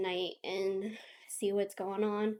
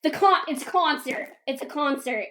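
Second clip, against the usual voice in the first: imitating a higher, louder excited reaction; excited realisation; 5 semitones higher, 7 dB louder than usual. Friend finds out about the surprise concert, excited. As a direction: blurt it out, mock-thrilled.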